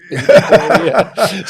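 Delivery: slow, high, loud, laughing; mock nervous plea. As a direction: ham up the nervous player. Mimicking players waiting nervously.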